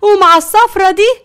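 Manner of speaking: high, loud accusation; loud, shocked fury turning to threat; shocked outrage. Acted. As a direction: confront a cheating husband, scandalised. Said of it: "وقالت بصدمة".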